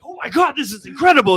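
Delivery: high, gushing excitement; overjoyed fan gushing. Retold or imitated. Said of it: "half the people were like".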